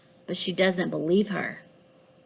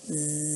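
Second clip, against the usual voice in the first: very quiet sleepy zzz; a sleeping cat's hum; soft snore; 5 semitones lower, 10 dB quieter than usual. Acted. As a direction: drift off into a snore. Cat falls asleep.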